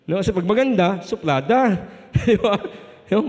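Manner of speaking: ordinary talk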